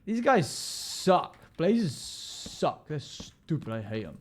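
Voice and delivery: frustrated gamer grumble; annoyed rant. Real, not acted. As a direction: whine it out at the blazes.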